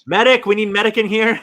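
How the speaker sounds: hammed-up alarm; mock emergency call. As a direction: playfully call out for a medic. Playfully calls medic after story of fainting.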